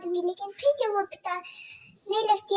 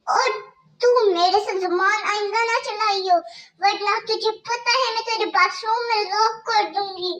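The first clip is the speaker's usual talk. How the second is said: harsh stepmother scolding; 4 dB louder than usual. Acted. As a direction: bark orders at the girl like a cruel stepmother.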